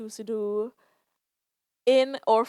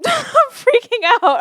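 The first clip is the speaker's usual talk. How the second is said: "I'm freaking out" said much higher and louder through a laugh; nervous laughing panic; 13 semitones higher, 12 dB louder, slower than usual. Real, not acted.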